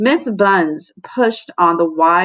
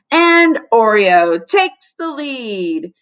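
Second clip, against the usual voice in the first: slow, drawn-out race call, a bit louder; sports announcer; 4 dB louder, much slower than usual. Acted. Jackie narrating the cookie race at lunch, sports announcer. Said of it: "in her sport announcer voice".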